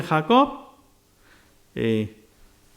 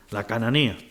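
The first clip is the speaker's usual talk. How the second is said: ordinary talk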